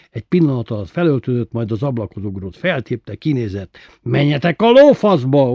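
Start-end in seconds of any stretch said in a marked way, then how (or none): 4.0-5.5 loud hoarse bellow out the window; hoarse yelling. A narrator's reading. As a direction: roar it at the peeping men. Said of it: "ordította rekedten".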